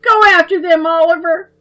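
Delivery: high, loud, crying yell; tearful yell. A narrator's reading. Little girl urging her brother to fight, crying and yelling. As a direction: shout it through angry tears. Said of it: "Babs yelled".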